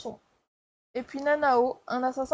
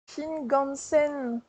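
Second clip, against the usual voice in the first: Shinkansen spelled out very slowly; careful slow pronunciation; much slower than usual. Shown as a demonstration. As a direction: pick your way through Shinkansen slowly. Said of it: "trop compliqué, hein". (Shinkansen prononcé très lentement)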